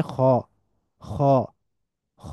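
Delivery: খ with a thick, heavy "a", neither flat nor "o"; heavy "a" sound. Shown as a demonstration. Teacher modelling the letter, খ with heavy thick "a". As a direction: thicken the vowel of খ into a full back "a". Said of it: "মোটা আ sound".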